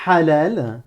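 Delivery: "halal" with a raspy, strangled throat h; Arabic ḥ sound. Shown as a demonstration. Teacher modelling "halal" with a throaty h. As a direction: scrape the breath on the h of "halal". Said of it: "un son qui commence le mot halal".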